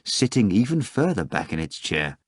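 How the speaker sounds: plain reading voice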